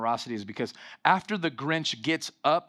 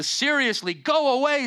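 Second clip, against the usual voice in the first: sign voice; 10 semitones higher, 5 dB louder than usual. Retold or imitated. Reading the Grinch's sign.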